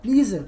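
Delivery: "pleaser" said as plee-zer; wrong take, "pleaser" with a buzzing z; mispronounced pleasure. Shown as a demonstration. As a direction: say "pleaser" with long ee and z as the mistake to avoid. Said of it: "please इसका pronunciation ध्यान दीजिएगा".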